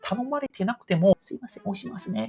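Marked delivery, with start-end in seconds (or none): 1.2-2.3 soft apology. Retold or imitated. Polite apology in the elevator.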